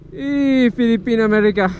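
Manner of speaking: high loud cheery shout; excited call. Real, not acted. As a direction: hail them across the street. Calls out to passersby.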